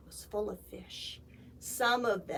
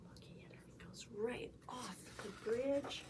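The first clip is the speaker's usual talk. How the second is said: usual speaking voice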